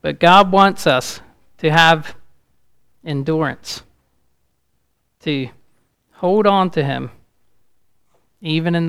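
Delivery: loud, rising preacher's build; fiery preaching. Acted. Pastor warning the congregation about life's storms, loudly.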